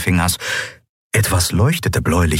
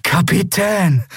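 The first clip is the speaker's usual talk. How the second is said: high, drawn-out relieved exclamation; relieved cry; 12 semitones higher, slower than usual. A narrator's reading. Miko recognising the captain, relieved cry. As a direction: stretch the word out in relief. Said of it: "Sie hörte ihn tief ausatmen".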